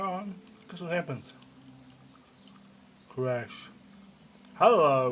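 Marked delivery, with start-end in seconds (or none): 4.5-5.1 sudden loud greeting; loud surprised hello. Real, not acted. Surprised at camera working.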